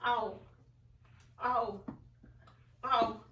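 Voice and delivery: ordinary delivery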